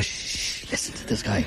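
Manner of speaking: shush, then whisper an urge to listen; soft voiceless aside to fellow listeners; hushed whisper. Retold or imitated. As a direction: imitate quietly hushing an audience. Hushing people for the singer.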